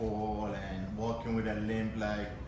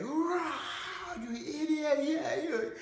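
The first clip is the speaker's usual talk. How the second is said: high, shrieked roar of a taunting monster; roaring fear monster; much slower than usual. Acted. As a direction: roar the insult like a beast at the curtain. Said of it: "fear is gonna like".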